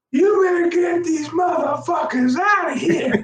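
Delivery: very high-pitched imitation of a yelling woman; mock irate shriek. Irate woman ordering police to clear people out, mock shrieking. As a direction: yell it high and drawn, sending her up.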